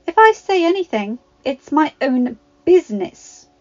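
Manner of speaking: bristling, put her brother in place; snapping. A narrator's reading. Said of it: "snapped Ginny".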